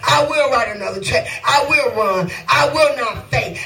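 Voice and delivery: high, shouting gospel cadence; rising sermon shout; preaching at full force. Acted. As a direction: preach it, lifting each claim over the music.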